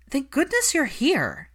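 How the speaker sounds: a worn-out nanny sighing with relief; frazzled nanny, thankful for rescue; relieved nanny. A narrator's reading. Frazzled nanny greets Hermione.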